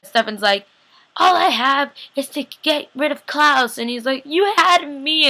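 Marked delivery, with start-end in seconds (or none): 1.2-3.8 high, slow, drawn-out protest; mock impassioned outburst, pitched up; acted anguish. Recapper as Stefan insisting on his only purpose, hammed-up anguish. 4.2-5.3 high, very slow mock-emotional cry; acted heartfelt outburst. Recapper as the hurt one answering Stefan, high hammed-up plea.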